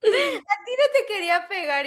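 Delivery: high-pitched grandma voice; imitated apologetic grandma. Grandma to wrongly hit granddaughter, high mock apology.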